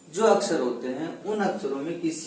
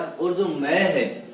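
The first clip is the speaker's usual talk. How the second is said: ordinary talk, nothing marked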